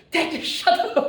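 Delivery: high, loud panicked outburst; imitated yell. Lecturer acting out people near a squealing microphone, yelling. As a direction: yell it out as if feedback just screeched. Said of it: "You yell and say".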